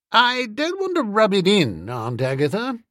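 high opening, dropping on the name; cool, understated reproach; cold. A narrator's reading. Nephew rebuking his chastened aunt, cold. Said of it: "I said coldly".

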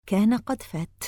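plain reading voice

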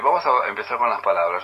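ordinary talk

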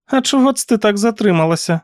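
high-pitched aunt character voice; questioning aunt. A narrator's reading. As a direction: interrogate the niece in a high voice. Aunt questions her late niece. (високий допитливий голос тітки)

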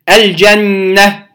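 الجنة slow, with a clear ل before the ج; إظهار اللام. Shown as a demonstration. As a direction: say "الجنة" slowly, sounding the ل and holding the doubled ن. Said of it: "نظهر اللام الساكنة". (الجنة ببطء مع إظهار اللام)

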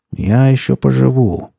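drawn-out, menacing vow; menacing promise. A narrator's reading. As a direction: promise it as a threat, stretching the words. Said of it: "с угрозой пообещал он".

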